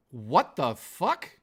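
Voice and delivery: ordinary talk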